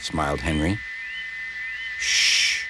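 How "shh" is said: sustained "shh"; hushing. A narrator's reading. Edward hushes Henry to listen, drawn hush. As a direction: hush someone so you can listen.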